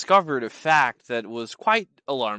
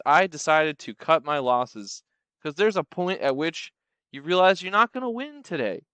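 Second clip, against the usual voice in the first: normal talking voice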